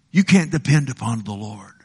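ordinary talk

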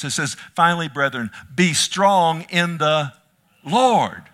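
loud, high revival sermon; full-force preaching. Acted. Preacher crying out Paul's words to the church, loud. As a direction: drive the scripture home to the room.

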